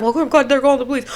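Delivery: quick, panicky retold worry; mock panicked babble; freaking out. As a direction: rattle it off in mild panic. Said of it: "I was freaking out because".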